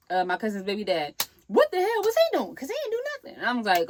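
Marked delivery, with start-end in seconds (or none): 1.5-3.4 very high-pitched indignant questioning; imitated indignation. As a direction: mimic a complaint in a high voice. Voices indignant complaint about the dad.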